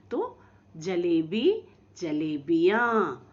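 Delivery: जलेबी then जलेबियाँ, both said slowly and firmly; slow pair demo. Shown as a demonstration. Teacher contrasting जलेबी and जलेबियाँ for children, slowly. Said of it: "पंखे कैसे उच्चारण करेंगे".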